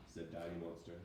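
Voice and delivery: ordinary delivery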